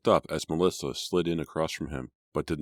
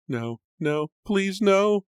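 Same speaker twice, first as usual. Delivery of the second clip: much higher, louder screaming plea; 6 dB louder, much slower than usual. A narrator's reading. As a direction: scream the denial. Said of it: "still screaming".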